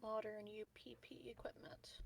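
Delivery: whispered, half to himself; a quiet whisper of surprise; muttered to self. Real, not acted. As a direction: breathe it out while thinking. Player mutters the in-game find.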